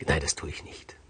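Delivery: whispered to himself; whispered thought. Acted. Giges whispering to himself alone in the queen's chamber. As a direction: breathe it out unvoiced, secretly.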